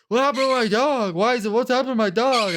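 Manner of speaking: high, loud mock panic; acted freak-out. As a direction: play a frantic owner crying out. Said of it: "they're, like, freaking out".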